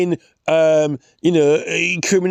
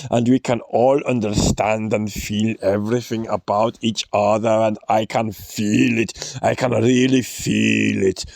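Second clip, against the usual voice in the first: mock Klaus Schwab; 5 semitones lower than usual. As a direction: purr it like a smug visionary. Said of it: "he's like".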